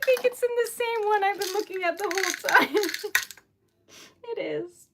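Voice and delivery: ordinary talk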